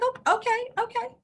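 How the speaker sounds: high, stunned "oh, okay". Retold or imitated. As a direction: caught off guard, repeating okay in shock. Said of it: "I was like".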